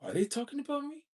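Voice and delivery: high, fast worried wondering; paranoid inner thought. Retold or imitated. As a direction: squeak it out, suddenly suspicious. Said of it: "and I think".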